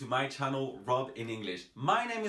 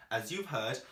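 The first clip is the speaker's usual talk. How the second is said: ordinary talk, nothing marked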